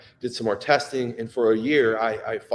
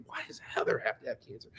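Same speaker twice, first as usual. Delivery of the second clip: whispered fast and much softer; bitter whisper; 3 semitones lower, 10 dB quieter, faster than usual. Real, not acted. Husband asking why his wife has cancer, whispered. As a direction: spit it out under your breath. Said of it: "pisses me off right now".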